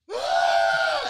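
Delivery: very high, loud scream; mock scream of an overwhelmed contestant; imitated shrieking scream. Called contestant screaming at her seat neighbour, imitated shriek. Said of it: "she's, like, screaming at me".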